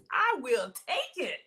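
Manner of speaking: ordinary delivery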